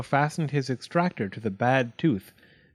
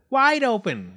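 high, loud command; raised voice, pitched up; yelled; 11 semitones higher, 5 dB louder than usual. A narrator's reading. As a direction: yell the order urgently. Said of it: "yelled his wife".

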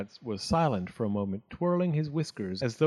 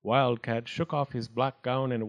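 ordinary delivery

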